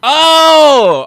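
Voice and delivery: sudden loud high oh; sharp burst of realization; surprised. Real, not acted.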